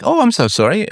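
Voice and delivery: mock apology. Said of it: "And she's like".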